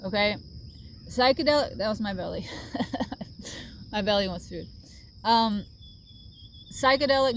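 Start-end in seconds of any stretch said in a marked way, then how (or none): 2.5-3.9 soft laugh. Real, not acted. Laughs at belly noise.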